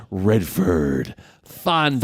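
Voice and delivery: lightly voiced trailer announcer; a hint of old trailer voiceover. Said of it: "old trailers are bad".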